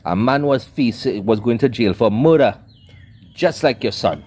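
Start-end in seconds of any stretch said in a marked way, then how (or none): none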